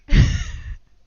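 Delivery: short, loud, high laugh; burst of laughter. Real, not acted. Streamer on money saved from Skirk, loud laugh. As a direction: let a loud laugh escape.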